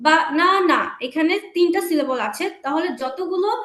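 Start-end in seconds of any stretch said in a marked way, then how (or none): 0.1-0.8 বা, না, না as separate louder beats; syllable by syllable. Shown as a demonstration. Teacher showing the clap trick, বা না না in loud syllables. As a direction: punch out বা-না-না, one beat each. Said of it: "কিভাবে দেখো".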